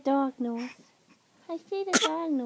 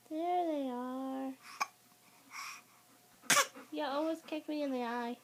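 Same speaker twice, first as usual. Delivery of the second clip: ordinary delivery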